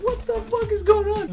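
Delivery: very high what the fuck is going on; incredulous. Retold or imitated. Biker watching two drivers brawl, high and disbelieving. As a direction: squeal it in disbelief. Biker baffled by the road rage.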